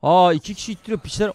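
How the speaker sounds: ordinary talk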